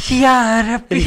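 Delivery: high, loud mock dismay with laugh; high exclamation. Real, not acted. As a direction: cry out then laugh.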